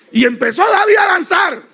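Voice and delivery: high, loud preaching cry; preaching shout. Acted. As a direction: shout the dancing out to the congregation.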